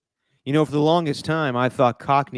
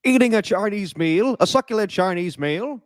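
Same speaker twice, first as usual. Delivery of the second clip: higher-pitched theatrical indignation; viral clip impression; 6 semitones higher than usual. Host mimicking the arrested man from the clip, theatrical indignation.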